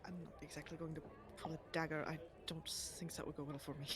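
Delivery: low and soft, self-deprecating; meek, hushed voice. Acted. Oleander assuring guards he is harmless, meek hush. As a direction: speak small and quiet to the guards.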